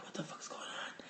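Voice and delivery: hushed, scared whisper. Retold or imitated. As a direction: whisper it, afraid to be heard. Both whispering in bed.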